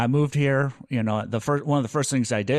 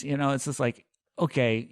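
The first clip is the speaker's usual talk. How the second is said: ordinary talk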